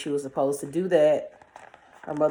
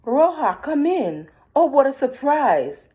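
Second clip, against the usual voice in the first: high, loud sweet voice; disguised wolf; 8 semitones higher, 5 dB louder than usual. A narrator's reading. Wolf pretending to be Roja, high and sweet. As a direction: fake a sweet girlish welcome.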